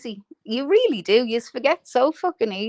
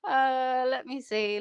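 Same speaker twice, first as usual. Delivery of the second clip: ordinary delivery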